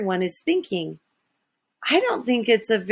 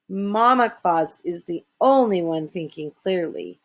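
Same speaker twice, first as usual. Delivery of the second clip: usual speaking voice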